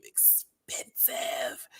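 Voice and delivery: "expensive" drawn out in a low, breathy whisper; whispered, exaggerated "expensive"; affected pronunciation. Shown as a demonstration. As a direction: hiss out "expensive" slowly, syllable by syllable. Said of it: "saying it that way".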